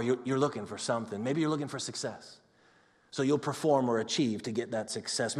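ordinary delivery

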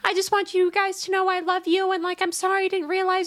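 high, sweet mock apology; mock sincere Tinkerbell. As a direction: gush a sappy apology. Tinkerbell's group message.

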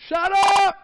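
high, loud hammed-up yell to shut them up; mock shout. Moses shouting at the panicking people, hammed-up. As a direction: bark it at the panicking crowd. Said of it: "That's actually what he said".

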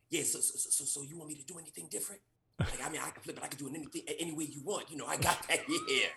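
a quiet, rambling in-character mumble; mumbled character voice. Retold or imitated. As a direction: mumble it like a spaced-out guy.

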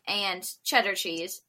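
ordinary delivery

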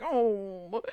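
drawn-out pouty "oh"; mock pout. Damon is refused a bite, mock pouting. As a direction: imitate a sulky pout, lightly. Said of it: "he pouts and he's like".

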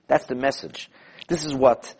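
usual speaking voice

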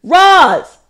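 loud, high call across the house; yelling her name. Real, not acted. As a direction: yell for someone in another room. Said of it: "do I just yell her name".

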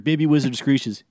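usual speaking voice

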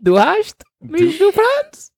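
high, drawn-out mock German question; slow, squeaky-high hammed-up German accent. Shown as a demonstration. Showing off a German accent, slow and high. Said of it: "What's your German accent?".

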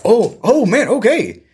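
hammed-up labor scare; mock panic. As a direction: play the startled parent. Mom thinks she's in labor.

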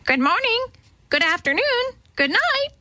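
very high squawking parrot; parrot cry. A narrator's reading. Parrot greets the three girls, crying out high. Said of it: "and cried".

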